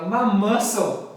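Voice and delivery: "My muscle" slow and loud, said like "mas sou"; pun punchline. Acted. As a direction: land "My muscle" as a slow joke. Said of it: "fala assim". ("My muscle" devagar e alto, como piada)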